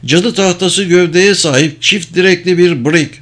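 high-pitched sighting report; calling out. A narrator's reading. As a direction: call the sighting to the captain. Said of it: "diye seslendi İdrayana".

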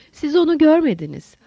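high-pitched, wounded protest; emotional outburst. Acted. Holmes turns on Lowell in an emotional outburst. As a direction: let the feeling burst out, pitch lifted. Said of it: "Holmes bir duygu patlamasıyla Lowell'a döndü".

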